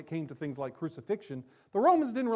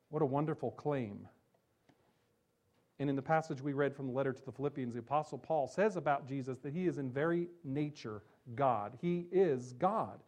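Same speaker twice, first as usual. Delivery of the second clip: normal talking voice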